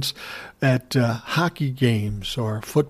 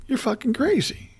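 much higher, scoffing wife's retort; mocking wife retort; 12 semitones higher, 4 dB quieter than usual. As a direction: toss it back as his incredulous wife. Wife retorts, quote acted out.

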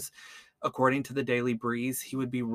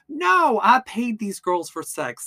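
loud, high protest, stretched on "No"; mock indignant denial; 10 semitones higher, 8 dB louder, slower than usual. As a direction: act out an outraged denial, slowed and raised. Retells killer's denial on the stand.

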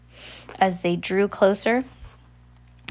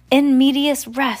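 raised, louder exclamation; crying out; 5 semitones higher, 5 dB louder than usual. A narrator's reading. Sunny protests to the facilitator, crying out. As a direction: cry it out higher and louder. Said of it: "Sunny cried".

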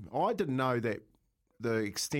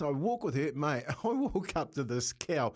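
ordinary delivery

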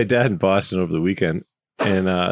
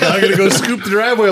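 loud, high mock quote of a local; 9 semitones higher, 5 dB louder than usual. Retelling a neighbor's odd phrase, loud and high. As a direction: belt it out like a puzzled local. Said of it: "The first winter I was there".

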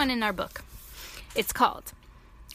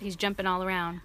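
ordinary delivery, nothing marked

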